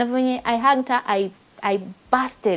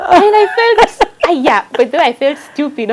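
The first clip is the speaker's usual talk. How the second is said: higher, louder, laughing through the words; laughing at herself; 7 semitones higher, 12 dB louder than usual. Real, not acted. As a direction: laugh at your own foolishness.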